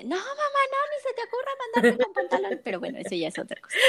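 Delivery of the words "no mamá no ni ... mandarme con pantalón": put-on much higher, pleading little-girl voice; whiny crying girl. Mother imitating her crying daughter begging not to wear pants, high and pleading. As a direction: whine like a tearful little daughter. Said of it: "la niña lloraba así de".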